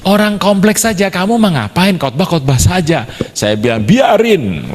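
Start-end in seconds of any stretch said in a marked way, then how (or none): 0.0-3.1 higher-pitched mock nagging; imitated scolding wife. Wife scolds her injured preacher husband, high imitated nagging. 3.8-4.7 high, punchy mock comeback; retold defiant retort. Husband answers his scolding wife, high defiant retort.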